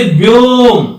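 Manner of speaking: व्योम held long and slow, the om stretched; व्योम with a long drawn om; drawn-out vyom. Shown as a demonstration. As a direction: stretch व्योम out slowly to show its length. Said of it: "ज्यादा समय लगता है".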